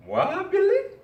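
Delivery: Wabile cried very high and loud; exuberant, high-pitched shout of Wabile; exclaimed name. Shown as a demonstration. Speaker guesses an African guest's name, high gleeful exclamation. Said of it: "say his name with emphasis".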